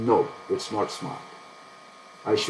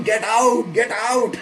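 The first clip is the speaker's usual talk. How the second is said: higher, louder get out cry, laughing through it; mock viewer yell; 9 semitones higher, 4 dB louder, slower than usual. Trader voicing his viewers shouting to sell, mock yell. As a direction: playfully shout the viewers' advice. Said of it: "you must be screaming".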